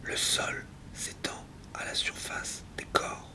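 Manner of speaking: very soft, unvoiced whisper. Alone at night with a mouse in the kitchen, whispered. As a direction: whisper it like a secret night-time observation.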